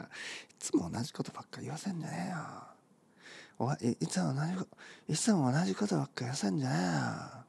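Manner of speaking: fast, soft muttered complaint; hushed casual grumble; mumbled whisper. Shown as a demonstration. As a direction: grumble it under your breath. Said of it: "ね、こんな感じね。".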